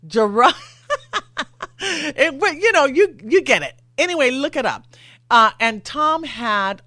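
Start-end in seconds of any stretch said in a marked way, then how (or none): 1.8-2.4 high giggle breaking through the word; embarrassed laugh. Real, not acted. Host laughing to producer Sullivan, high giggle. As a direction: laugh at your own stumble.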